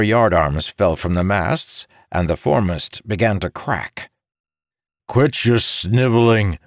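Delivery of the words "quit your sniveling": a sharp, louder scold; snapping. A narrator's reading. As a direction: bite his head off for whining. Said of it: "Morgidian snapped at Jacob".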